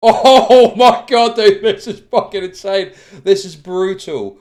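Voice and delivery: slow, loud laughing disbelief; real astonished laugh; shocked laughter. Real, not acted. Streamer reacting to disaster footage, laughing in shock.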